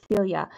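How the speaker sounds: "Celia" with a long "ee" and a y-glide; mistaken name pronunciation. Shown as a demonstration. Stitcher confessing how she keeps saying a viewer's name, mistaken pronunciation. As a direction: say "Celia" as SEEL-ya. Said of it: "I keep wanting to say".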